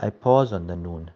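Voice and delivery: ordinary talk, nothing marked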